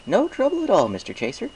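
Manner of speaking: high, friendly shopkeeper pony voice; chirpy baker pleased with business; Mister Cake's voice. A narrator's reading. Baker thanks Applejack for the apples, chirpy and friendly.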